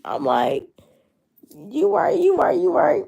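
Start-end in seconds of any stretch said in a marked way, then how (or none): 1.7-3.0 high, loud, emphatic agreeing; excited agreement. Retold or imitated. Retelling her reply to God, high and loud. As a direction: repeat it with mock fervour, pitched up.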